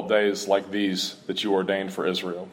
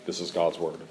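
ordinary talk, nothing marked

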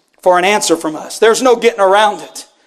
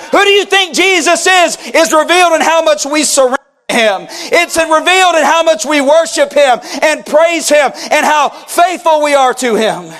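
fervent preacher, raised and driving; preaching at full force; 5 semitones higher than usual. Acted. Pastor pressing the church with a fervent call. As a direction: press the question on the congregation. Preacher building his point.

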